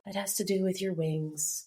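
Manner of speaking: hushed, softened imitation; very soft. Imitating Cassian talking about the wings, very soft. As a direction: say it softly, with a boyish gentleness. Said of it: "John being so soft about".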